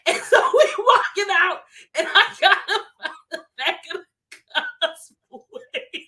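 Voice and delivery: high, laugh-choked storytelling; laughing while talking. Real, not acted. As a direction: barely hold in the laughter. Laughing as she tells the drag-out.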